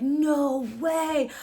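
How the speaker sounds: high "no way"; amazed. Retold or imitated. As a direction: awestruck. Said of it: "I'm just like".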